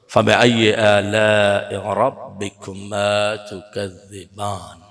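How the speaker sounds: measured Quranic chant with long vowels; Quran recitation. Shown as a demonstration. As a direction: draw out each vowel as in Arabic recitation. Recites Surah Rahman verses.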